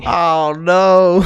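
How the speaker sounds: hammed-up cry of disappointment; mock dismay. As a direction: wail it in pretend dismay. Playing along with mock drive-thru scene.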